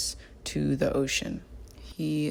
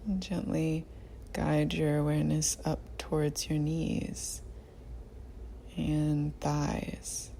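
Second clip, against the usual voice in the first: guided meditation; 6 dB quieter than usual. Real, not acted. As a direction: guide them gently through the body, unhurried. Leads a body scan meditation.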